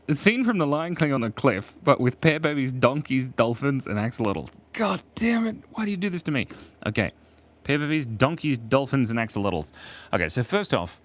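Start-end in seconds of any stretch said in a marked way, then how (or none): 4.7-6.5 high, whiny playful complaint; hammed-up despair; mock exasperation. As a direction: protest in a pitched-up voice at an impossible request. Mock complaint at hard drawing request.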